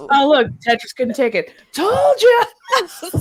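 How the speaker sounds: high, laughing told-you-so, shouted at the end; smug fish voice. Pretend. Fish gloating at the owner over a dead tankmate, high and smug.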